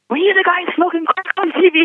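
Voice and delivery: very high, squeaky retold question; awkward blurt. Starstruck newcomer blurting at the pastor, high and excited.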